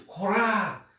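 high aggressive cry with a long final vowel; anime battle cry. Shown as a demonstration. Teacher repeating the anime attack cry, aggressive yell. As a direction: shout it high, falling at the end. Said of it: "Dá esse grito".

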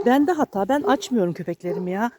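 ordinary talk, nothing marked

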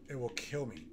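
ordinary talk, nothing marked

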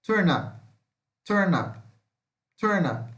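"Turn up" with the last vowel pulled toward "ah"; I leaning to A. Shown as a demonstration. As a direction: model "Turn up" crisply, stress first, pitch falling. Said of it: "ele vai puxar pro A".

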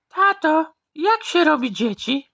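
put-on high childish question. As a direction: ask like a small kid. Child asks father.

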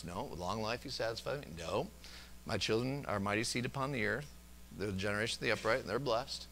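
quiet under-breath scripture. Shown as a demonstration. Preacher demonstrating how he quietly speaks verses, murmured. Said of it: "just under my breath".

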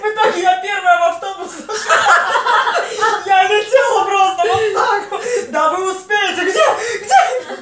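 high and loud, laughing throughout. Retold or imitated. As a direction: laugh while telling it. Laughing retelling of rushing.

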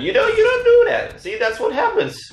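very high, squealed mock scolding; taunting scolding. Real, not acted. Player taunting an opponent who made a mistake, mock scolding. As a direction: jump up high and chide them teasingly.

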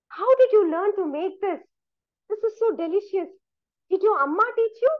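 high-pitched, eager praise and curiosity; pleased diner quizzing the cook; delighted questioning. A narrator's reading. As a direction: ask brightly, pitched well up.

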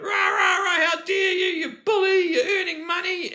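high, loud mock shouting; mocking her rant. Mocking the woman's angry texts to me, high loud mock shout. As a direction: mimic her ranting back, high and loud. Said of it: "And then she was like".

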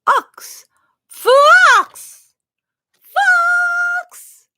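fox shouted up high and loud; high excited shout. Shown as a demonstration. Teacher cheering fox with the kids. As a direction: call out fox loudly, high. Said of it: "다시 해볼까요".

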